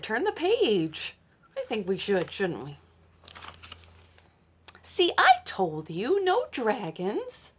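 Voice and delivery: ordinary delivery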